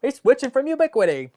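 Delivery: high, loud put-on voice; mock voice of Jason. Mimicking viewer Jason cheering his brand switch, high loud mock voice. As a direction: squeeze the mouth into a silly high gloat. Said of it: "If I could make my mouth go like this".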